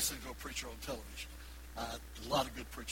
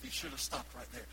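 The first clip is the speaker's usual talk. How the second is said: ordinary talk, nothing marked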